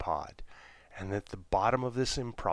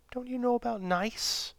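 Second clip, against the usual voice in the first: high squeaky voice; 14 semitones higher than usual. Acted. Jenny asks the alien.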